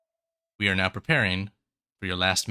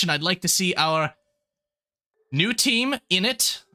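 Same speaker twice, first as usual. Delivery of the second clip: put-on high-pitched, louder old professor; 7 semitones higher, 3 dB louder than usual. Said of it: "Professor Alistair will step forward and say".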